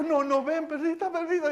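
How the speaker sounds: se corrige con voz aguda de mimo; engatusando. Retold or imitated. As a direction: imita un llamado cariñoso a la perrita. Rectifica y llama con dulzura. (suplicante, agudo, sin gritar)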